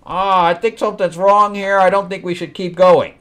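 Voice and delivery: a hint of whiny unease; mock worried woman. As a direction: imitate her having second thoughts. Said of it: "she flip-flops and says".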